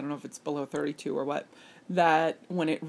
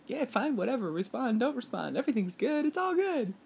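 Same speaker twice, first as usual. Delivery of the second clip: laid-back; 7 semitones higher, 3 dB quieter than usual. Retold or imitated. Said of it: "the Australian guy is just like".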